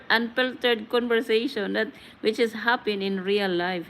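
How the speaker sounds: normal talking voice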